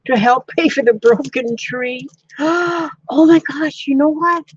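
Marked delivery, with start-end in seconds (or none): 2.4-3.1 high, quick gasp; excited gasp. Real, not acted. Crafter struck by an idea, excited gasp. 3.1-4.4 high, loud burst of excitement; sudden excitement. Real, not acted. Crafter telling viewers of a sudden idea, excited.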